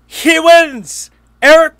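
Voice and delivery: high, loud exclamation of disbelief; exasperated outburst. Real, not acted. Podcaster blowing up over the Suns' mistake, loud and high. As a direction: throw it out like the worst possible outcome. Outraged at Suns sending him home.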